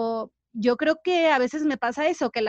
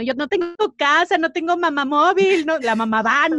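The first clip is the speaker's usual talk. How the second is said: high, loud comic wail; hammed-up sobbing complaint; mock crying; 5 semitones higher, 5 dB louder than usual. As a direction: whine tearfully about all you lack. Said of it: "yo lloraba".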